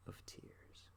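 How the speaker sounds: faded whisper. Retold or imitated. Ending the recited song in a whisper. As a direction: let it die away in a whisper.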